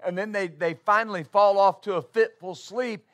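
loud, rising preacher's cadence, driving each point home; preaching at full force. Acted. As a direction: preach it hard to the congregation.

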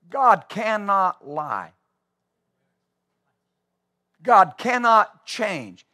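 hushed, slow conviction. Acted. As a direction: drop your voice and let it land. Quiet solemn declaration.

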